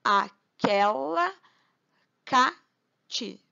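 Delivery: "Aquela cati" in slow, separated syllables; slow scansion, pausing between syllables. Shown as a demonstration. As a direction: draw out each syllable of "Aquela cati" for counting. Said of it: "é só contar tranquilamente".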